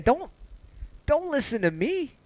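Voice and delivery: "don't" pushed high with a long rise, slowed; high pleading don't. Real, not acted. Streamer begging viewers not to follow him, high rising plea.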